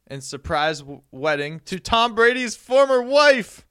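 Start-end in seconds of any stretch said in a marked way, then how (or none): 1.7-3.7 loud, high fake news reveal; mock bombshell announcement. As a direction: blurt it as pretend scandal. Fake shock reveal, then "Just kidding.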